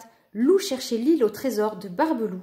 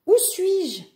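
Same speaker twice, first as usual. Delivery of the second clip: bewildered cry; 8 semitones higher, 4 dB louder than usual. A narrator's reading. Lou wakes up lost, cries out.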